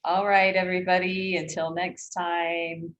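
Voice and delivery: drawn-out podcast goodbye; slow sign-off. Acted. Host says goodbye to the audience, slow and drawn out. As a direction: stretch the farewell slowly.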